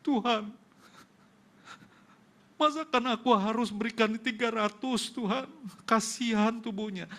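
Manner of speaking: high, loud, anguished plea to God; a husband recalling praying over his sick wife, weeping; tearful prayer. Retold or imitated. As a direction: cry out to God through tears. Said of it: "Aku menangis".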